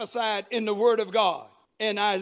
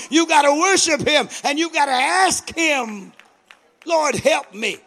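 loud, high-pitched driving exhortation; full-force preaching; 3 semitones higher, 7 dB louder than usual. Real, not acted. Preacher urging the congregation to praise God, full-force preaching. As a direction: build phrase on phrase to a shout.

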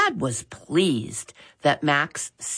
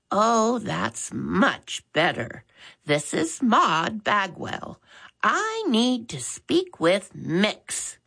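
put-on slow, higher old lady voice; drawn-out, pleased old woman; elderly caller; 6 semitones higher, slower than usual. Maud Bagwell introduces herself to Max, slow old lady voice.

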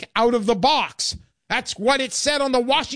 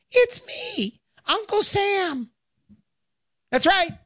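much higher, slow squeaky announcement; tiny Uncle Sam voice; 11 semitones higher, slower than usual. Retold or imitated. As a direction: drag out the name in a tiny voice. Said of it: "the little voice says".

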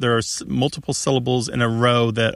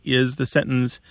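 usual speaking voice

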